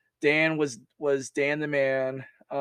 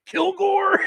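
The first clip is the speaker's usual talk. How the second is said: high, loud baffled "Kilgore?" as if mishearing; shouted confused mishearing of a soldier's name; surprised shout; 5 dB louder than usual. Retold or imitated. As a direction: blurt the name back in shock. Sergeant major mishears the name.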